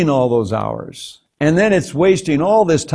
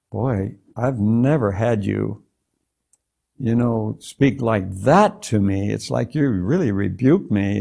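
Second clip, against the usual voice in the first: shaken, humbled; 5 semitones lower, 5 dB quieter than usual. Retold or imitated. Man answers God after being rebuked, retold softly, shaken and humbled. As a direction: retell a subdued, awed reply after a rebuke. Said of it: "I'm kinda trembling".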